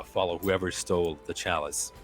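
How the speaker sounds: ordinary talk, nothing marked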